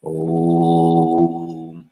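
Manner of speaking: long, low sustained "oh"; held chant tone. Shown as a demonstration. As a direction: hum out one steady low note. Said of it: "Drop that down a little".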